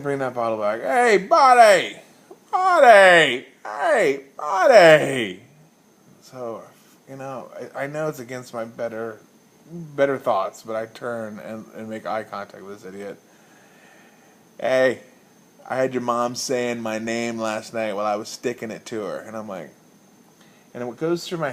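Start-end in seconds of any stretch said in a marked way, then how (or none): 0.8-5.4 put-on loud, high, slow drunken holler with a laugh; much louder laughing drunk shout; drunken laughing yell. 14.6-19.2 put-on drunk taunt.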